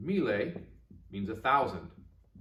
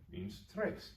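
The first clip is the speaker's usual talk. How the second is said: whisper; 9 semitones higher, 10 dB quieter than usual. Shown as a demonstration. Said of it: "quiet repeat".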